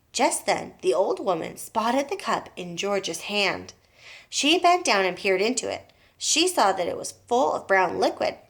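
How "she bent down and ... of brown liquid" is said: ordinary delivery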